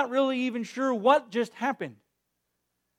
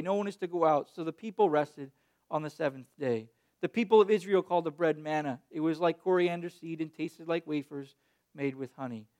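lower, softer Bible reading voice; scripture reading; 7 semitones lower, 6 dB quieter than usual. Acted. Pastor reading Exodus to the congregation, solemn reading.

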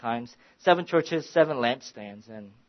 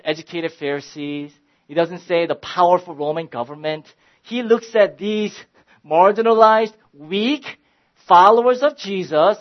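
higher and louder, building to a fervent sermon climax; preaching at full force; 6 semitones higher, 11 dB louder than usual. Acted. Pastor urging his small church, full-force preaching. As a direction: preach it out over the congregation, rising.

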